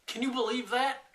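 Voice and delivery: high, incredulous outburst; indignant disbelief. Real, not acted. Telling viewers about his laughing friend, high incredulous outburst.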